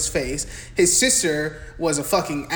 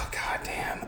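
whispered goddamn after a sigh; weary whispered curse; 11 dB quieter than usual. Real, not acted. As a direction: breathe it out under your breath.

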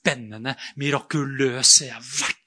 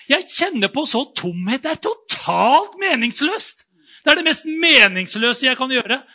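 building higher and louder, intense; mounting urgency; 7 semitones higher, 5 dB louder than usual. Retold or imitated. Telling Jarl on the train, rising urgency. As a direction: press the point to Jarl.

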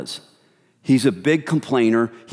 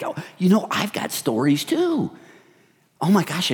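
eager gossip; 6 semitones higher than usual. Retold or imitated.

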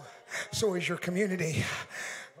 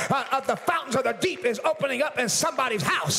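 preaching at full force; 6 semitones higher than usual. Acted. Pastor to his church, full-force preaching shout. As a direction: build the sermon to a shouting climax.

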